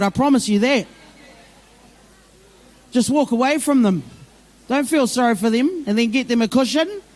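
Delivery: usual speaking voice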